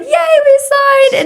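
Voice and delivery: high, slow sing-song yay; mock cheer. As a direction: cheer it out like a kid. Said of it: "Nimi thinks I'm gonna be like".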